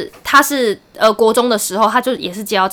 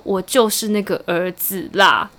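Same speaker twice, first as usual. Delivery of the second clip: deadpan reveal; 4 semitones lower, 6 dB quieter, slower than usual. Retold or imitated. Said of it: "他就说".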